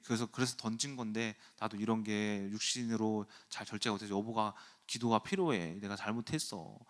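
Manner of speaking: low, soft, contrite husband; retold apology. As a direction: apologize softly to soothe your wife. Acted apology to his upset wife. (낮고 부드럽게 사과하는 남편)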